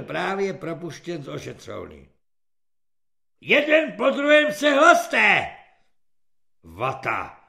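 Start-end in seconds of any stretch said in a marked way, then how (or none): 3.4-5.5 loud drill command; shouted order. A narrator's reading. 6.6-7.4 low grunting growl of a name; lightly voiced grunting kulak; grunted name. A narrator's reading. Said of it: "zachrochtal kulak".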